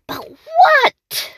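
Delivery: high squeaky witch asking; a shrill-free high puzzled witch query. Acted. The hungry witch asking herself, in a high witch voice. Witch wonders what to eat.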